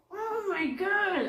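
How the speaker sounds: slow, high drawn-out exclamation; astonished. Retold or imitated. Speaker stunned by the flock, slow high amazement. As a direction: let the amazement stretch out.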